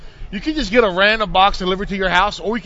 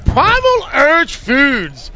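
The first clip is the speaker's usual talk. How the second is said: slow announcer ad voice; ad read; 4 semitones higher, much slower than usual. Acted. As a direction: announce the sponsor slowly. Sponsor ad read.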